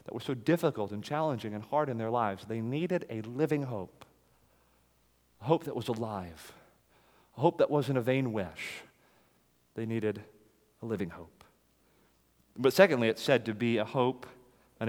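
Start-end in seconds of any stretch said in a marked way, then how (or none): 2.4-11.4 quiet earnest stress; hushed emphasis. Real, not acted. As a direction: let it sink in gently. Preacher softens on needed hope.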